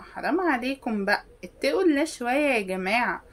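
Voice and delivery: ordinary talk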